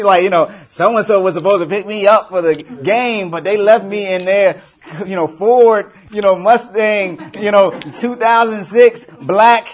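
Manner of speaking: high, loud laughing gossip voice; imitating a gossipy Facebook post, high and loud; mocking shocked gossip. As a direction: gossip it up, amazed and giggling. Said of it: "shots fired on Facebook that you go".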